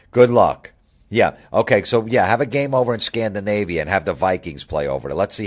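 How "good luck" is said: "Good luck" punched louder with contempt; louder mocking send-off; louder sarcastic good luck. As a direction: throw the "Good luck" out loud and dismissive. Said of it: "sarcastic repeated good luck".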